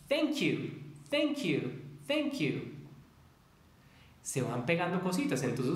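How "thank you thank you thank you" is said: "Thank you" high and quick, K glued to "you"; linked. Shown as a demonstration. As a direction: rattle off "Thank you" as one sound.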